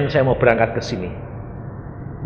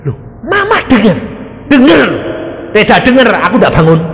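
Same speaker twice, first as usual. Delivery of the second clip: mother's voice; 9 semitones higher, 4 dB louder than usual. Retold or imitated. Voicing mother waking from coma.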